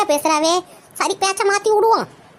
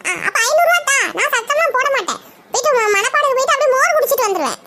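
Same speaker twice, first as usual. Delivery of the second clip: put-on higher, teasing young boy's voice; cheeky grandson voice; 4 semitones higher than usual. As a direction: wheedle like a spoilt grandson asking for money. Grandson voiced by the same actor. (செல்லப் பேரனின் உயர்ந்த குரல்)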